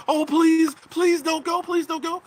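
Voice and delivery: high, whiny pleading voice; mock begging. Mocking a viewer begging him to stay, high whiny plea. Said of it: "waiting for people to go ahead and beg them".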